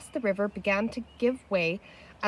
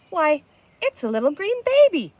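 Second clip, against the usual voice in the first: surprised delight; 6 semitones higher, 6 dB louder than usual. Acted. Patsy finds baby in river.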